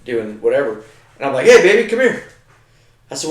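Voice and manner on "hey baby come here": high, loud call to his wife. Retold or imitated. Husband calling wife in from the foyer, loud and high. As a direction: call her in from the next room.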